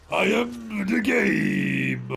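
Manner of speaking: mock the big wrestler's grand promo, dragging every word; Triple H impression. Imitating Triple H to a friend, slow pompous promo. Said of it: "he talks like this".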